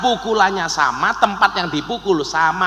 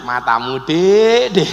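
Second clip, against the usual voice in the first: joking, teasing; 3 semitones lower, slower than usual. Acted. Husband joking with wife over the same words, teasing. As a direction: tease a sweetheart affectionately. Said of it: "Tapi lak guyon".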